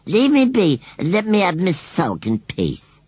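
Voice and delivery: high, loud, slow rustic voice; sulky scarecrow. A narrator's reading. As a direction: grumble stubbornly as a country scarecrow. Scarecrow demands to sulk alone.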